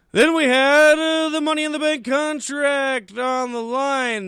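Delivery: high, booming, drawn-out match announcement; mock announcer. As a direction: belt out the billing with stretched words. Host introduces next match like announcer.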